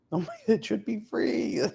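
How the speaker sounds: high, soft mock voice; put-on narrated voice. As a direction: pitch it way up, softly, as a playful character. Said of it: "narrating it in that voice".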